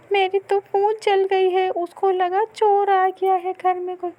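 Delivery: high, loud character voices in dialogue; mother and daughter voices. Acted. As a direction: voice the mother scolding, daughter complaining. (ऊँची आवाज़ में माँ-बेटी का संवाद)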